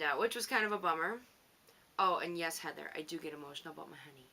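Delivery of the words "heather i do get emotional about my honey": hushed confession to Heather; whisper. Real, not acted. Whispering to Heather about getting emotional over her honey. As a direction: whisper it like a secret.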